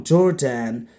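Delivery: "Jordan" with a full second vowel, jor-DAN; wrong pronunciation. Shown as a demonstration. Teacher copies what some students say, "Jordan" ending in full "dan". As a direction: give "Jordan" a clear "dan" like the name Dan. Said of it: "it's wrong".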